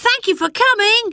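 high, bright calling thanks; children calling out gladly; children thanking the visitor; eager thanks. A narrator's reading.

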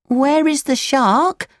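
put-on high, slow puppet boy question; Pinocchio, puzzled. A narrator's reading. Pinocchio questions the boys, high puzzled voice.

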